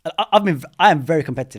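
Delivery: loud, emphatic, fired up about being competitive; fired-up energy. Real, not acted. Guest proudly admits to host he's very competitive, energetic and loud. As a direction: declare it with real fire and energy. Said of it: "even the energy and when you said yes".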